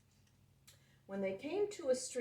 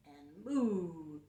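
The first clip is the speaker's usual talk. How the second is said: calf moo; 3 semitones lower than usual. Acted. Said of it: "calf called".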